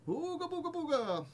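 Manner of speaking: high goofy caveman babble; silly caveman voice. Acted. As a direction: ham it up like a caveman. Silly noise while fixing stream.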